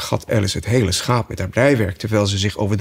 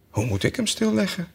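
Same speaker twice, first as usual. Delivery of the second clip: a hint of a high, softer sheep; puzzled sheep; 10 semitones higher, 5 dB quieter than usual. Sheep asks Alice how, softly high. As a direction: ask it dryly. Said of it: "zei het schaap".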